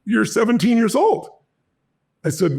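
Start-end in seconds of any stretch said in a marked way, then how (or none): 0.0-1.3 1 surprised. Retold or imitated. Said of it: "and then she goes".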